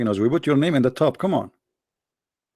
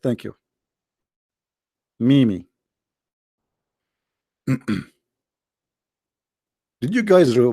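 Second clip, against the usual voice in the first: ordinary talk, nothing marked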